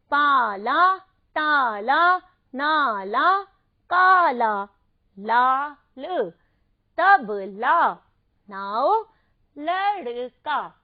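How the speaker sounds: each word called out loud and separate; loud word list. Shown as a demonstration. Teacher reading practice words aloud for kids. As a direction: read the list out one word at a time, pausing. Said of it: "इन्हें पढ़ना भी है".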